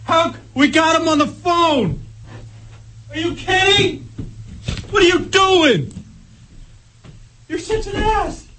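incredulous shouting. Real, not acted. Disbelief at team collapse.

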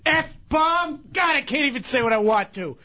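high, shouting rant, daring him; ranting and raving. Real, not acted. Chunks daring the prankster to come down, shouting in a high rant. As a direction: yell the challenge, barely holding back swears. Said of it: "All that ranting and raving".

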